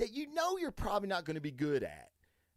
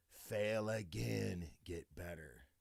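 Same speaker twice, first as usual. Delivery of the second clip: low, drawn-out, trailing softer; slow, hushed motto; 9 semitones lower, 8 dB quieter, slower than usual. Shown as a demonstration. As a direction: let the mantra sink in, slowly and quietly. Motto repeated slower and lower for emphasis.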